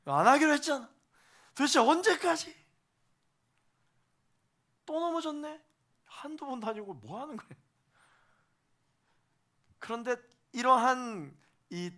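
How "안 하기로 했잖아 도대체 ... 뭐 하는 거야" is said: higher, louder exasperated nagging; God nagging a believer who fell again, exasperated; nagging scold. Acted. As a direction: scold like a fed-up parent. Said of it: "하나님의 잔소리가 시작됩니다".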